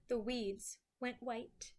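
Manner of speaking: ordinary delivery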